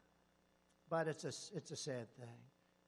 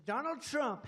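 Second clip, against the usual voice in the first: higher and louder, announcing; mock headline; 8 semitones higher, 7 dB louder, slower than usual. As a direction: blare it like a headline. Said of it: "they go out and they say".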